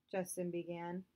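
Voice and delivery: usual speaking voice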